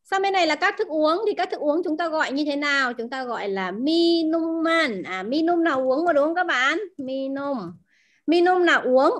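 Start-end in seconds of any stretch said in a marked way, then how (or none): 2.9-5.0 minuman stretched out slowly as a model; slow down on minuman so learners catch it; slow model word. Shown as a demonstration. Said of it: "chúng ta đọc là minuman". (kéo dài chậm chữ minuman)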